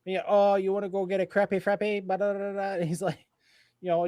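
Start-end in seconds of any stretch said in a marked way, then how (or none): 0.3-2.7 Tio Papi. Retold or imitated.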